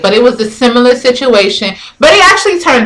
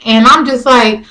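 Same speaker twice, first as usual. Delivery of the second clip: ordinary talk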